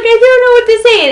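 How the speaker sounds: high, loud breathless gasping disbelief; overwhelmed squeal. Retold or imitated. Girlfriend answering the surprise proposal on stage, squealing high and loud.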